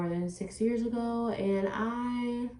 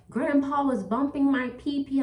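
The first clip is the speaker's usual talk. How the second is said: higher, lightly voiced child quote; imitated little girl; 6 semitones higher, 3 dB louder than usual. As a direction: echo a young daughter's voice slightly. Quotes her young daughter.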